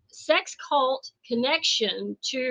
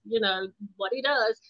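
normal talking voice

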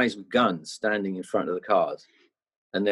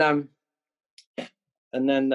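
ordinary talk, nothing marked

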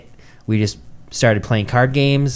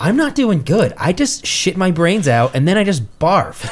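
high-pitched, exasperated complaint; worked-up storyteller quoting himself; retold distressed outburst; 8 semitones higher than usual. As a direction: replay your panicked admission, pitched way up. Retells his sick outburst to friends.

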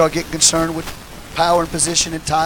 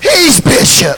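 He's bishop shouted out; shouted repeat; 9 semitones higher, 5 dB louder, much slower than usual. Real, not acted. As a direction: call He's bishop out loud. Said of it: "shouted repeat".

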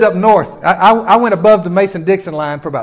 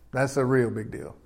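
dropped to a much softer repeat; quiet echo; 6 semitones lower, 10 dB quieter than usual. Real, not acted. Preacher echoing his point to the church, hushed. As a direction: say it again almost under the breath.

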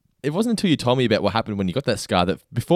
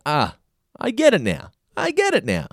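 high-pitched dawning realisation, retold; 8 semitones higher than usual. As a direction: act out the penny dropping, pitched up. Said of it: "I watched it and I was like".

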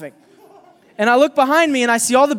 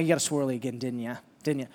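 knowing quiet accusation; 7 semitones lower, 10 dB quieter, faster than usual. Retold or imitated. Teacher teasing the boy quietly.

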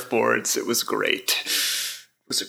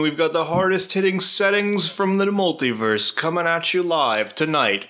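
announcer; 3 semitones higher, slower than usual. Acted. Fight-night announcer hype.

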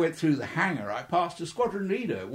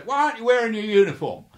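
higher and louder mock rebuke; stern officer; 8 semitones higher, 8 dB louder, faster than usual. Officer demanding why the pilot's out of uniform, higher louder mock rebuke. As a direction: play the officer telling off a junior.

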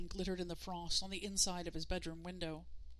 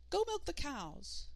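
put-on higher, slow piping boy voice; small child voice; 7 semitones higher, slower than usual. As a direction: try the words in a tiny voice. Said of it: "just to test out his voice again".

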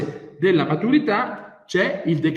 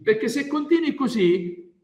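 ordinary delivery

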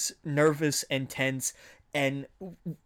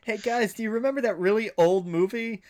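put-on higher, louder eager kid's voice; Spider-Man; 5 semitones higher, 4 dB louder than usual. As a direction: pipe up like an excited youngster pitching an idea. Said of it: "he was the one that thought".

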